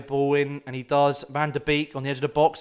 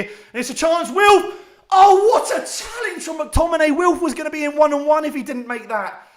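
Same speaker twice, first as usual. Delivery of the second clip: high, loud cry as the chance opens; yelling at the screen as a chance goes begging; excited shout; 10 semitones higher, 6 dB louder than usual. Real, not acted. Counter-attack chance, fan shouting.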